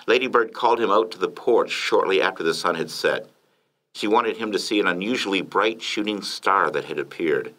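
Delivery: plain reading voice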